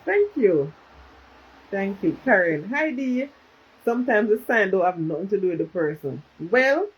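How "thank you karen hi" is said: ordinary delivery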